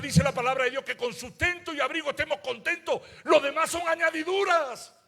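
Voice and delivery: loud, high preaching voice, stretched out; fiery preaching. Acted. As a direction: preach it full out to the congregation. Preacher's climax.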